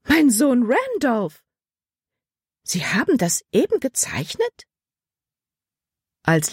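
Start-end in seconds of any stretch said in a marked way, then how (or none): 0.0-4.7 amazed exclamation. A narrator's reading. Frau Weaver recognises her son's portrait, amazed. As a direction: marvel at it, pitched high. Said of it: "Frau Weavers Ausruf unterbrach Libbys Gedanken".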